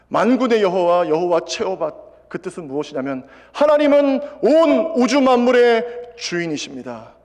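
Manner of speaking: loud, high, slow proclamation; proclaiming sermon. Acted. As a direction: declare God's name to the congregation. Preaching proclamation. (하나님의 이름을 선포하듯 크고 높게)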